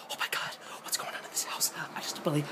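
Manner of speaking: hushed, hurried whisper of a horror heroine; scared whisper. Retold or imitated. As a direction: whisper nervously, acting out a scared woman. Acting out film heroine, scared.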